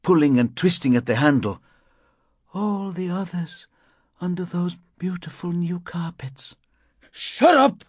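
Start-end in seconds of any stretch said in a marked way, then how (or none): 2.5-6.5 high, soft, faint voice; faint child-like whisper. A narrator's reading. Woman behind barricaded door, faint child-like whisper. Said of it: "A child whispering to a friend". 7.1-7.8 high, loud outburst; angry scream. A narrator's reading. Narrator at the barricaded door, angry scream.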